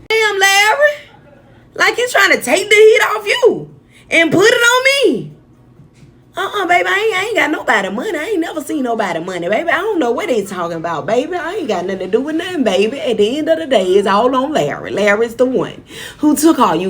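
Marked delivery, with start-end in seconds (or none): 0.1-5.3 high, loud crying yell of Damn, Larry; high pitched hurt outcry; mock upset yell. Voicing upset person confronting Larry. 6.4-13.0 put-on high, fast sing-song denial ending each bit on baby; rapid high voice insisting innocence; mock innocent denial. Imitating Larry denying everything.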